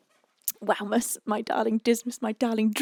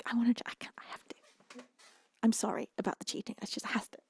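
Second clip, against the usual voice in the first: guilty whisper; 6 dB quieter, much faster than usual. Real, not acted.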